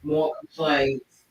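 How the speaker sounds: usual speaking voice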